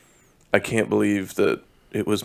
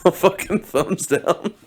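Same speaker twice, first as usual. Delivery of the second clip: mock outrage; 8 semitones higher, 4 dB louder than usual. Real, not acted.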